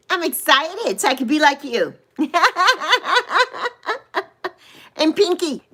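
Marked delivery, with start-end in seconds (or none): 2.2-4.2 high, rippling laugh; giggle. Real, not acted. Streamer excited to be like Rena, giggling. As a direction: laugh in a long run of giggles. Laughs while greeting viewers.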